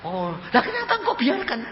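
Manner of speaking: high, baffled question to God; re-enacted high bewilderment; dumbfounded. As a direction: ask in astonished protest. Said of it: "saya melongo".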